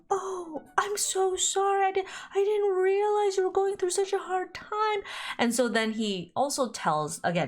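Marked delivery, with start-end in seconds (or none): 0.1-5.0 high, gushing mock sympathy; sympathetic family. Family consoles him for his hard time, mock-sympathetic. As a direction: pitch it way up, cooing an apology.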